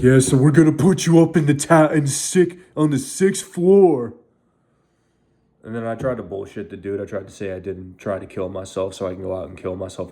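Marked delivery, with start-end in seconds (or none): 0.0-4.1 high, loud imitation of a gleeful doctor; mock him with singsong, pitched-up cheer; mock chipper doctor. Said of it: "comes in with a fucking ear-to-ear smile".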